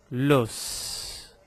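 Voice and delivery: "Los" with a long hissed final s; S final prononcé. Shown as a demonstration. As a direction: hold the s at the end of "Los". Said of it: "é pronunciado".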